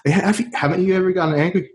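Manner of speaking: lightly imitated nosy question; mimicked questioner. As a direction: pitch it up as someone else asking. Mimics people who keep asking.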